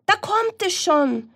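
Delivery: very high rooster crow. Acted. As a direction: crow out the news. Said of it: "krähte der Hahn".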